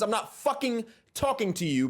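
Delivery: usual speaking voice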